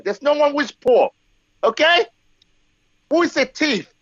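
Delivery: usual speaking voice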